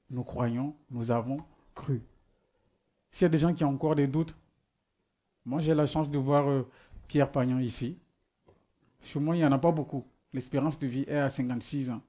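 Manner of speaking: ordinary delivery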